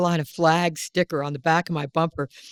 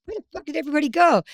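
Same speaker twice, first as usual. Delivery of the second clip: exasperated; 9 semitones higher, much faster than usual. Retold or imitated. Retold frustrated quote, high fast.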